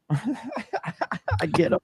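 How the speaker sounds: said through a laugh. Real, not acted. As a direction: laugh the words out. Laughs while speaking.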